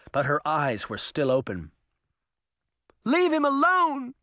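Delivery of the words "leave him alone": high, loud begging cry; desperate plea. Acted. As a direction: beg him to stop. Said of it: "Sabrina begged".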